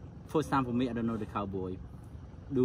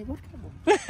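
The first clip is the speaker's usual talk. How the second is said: puzzled. Retold or imitated. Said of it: "I say".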